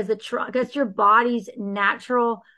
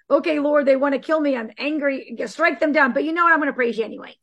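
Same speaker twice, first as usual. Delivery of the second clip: raised, louder anguished plea to God; voicing David's prayer; 5 semitones higher, 3 dB louder than usual. David crying out to God from the Psalms, urgent raised plea. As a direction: pour out David's fear and fury, then defiant praise. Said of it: "they all are David".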